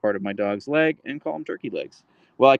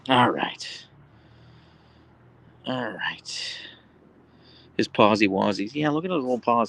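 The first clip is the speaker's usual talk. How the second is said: ordinary delivery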